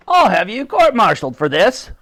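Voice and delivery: loud, high-pitched angry threat; raging. A narrator's reading. The Admiral threatens the clumsy private, raging. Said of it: "raged the Admiral".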